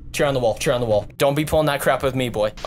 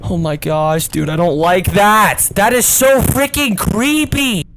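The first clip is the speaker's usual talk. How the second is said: scared shouting; 6 semitones higher, 6 dB louder than usual. Real, not acted. Face jump scare again.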